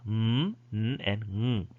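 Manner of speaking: m, n and ng hummed separately; demonstrating nasals. Shown as a demonstration. Teacher showing students the nasals, demonstrating. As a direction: hum each nasal: m, n, ng. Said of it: "So these are nasals".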